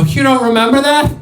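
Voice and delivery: high-pitched incredulous question; retold needling disbelief; mock disbelief. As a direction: act amazed that they forgot. Acted taunting of a player.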